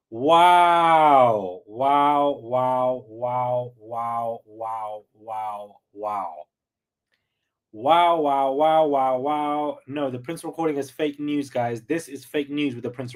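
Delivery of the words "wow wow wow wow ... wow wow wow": stunned wow repeated, fading to a hush. Real, not acted. Reacting to the lawyer, disbelieving wows trailing off.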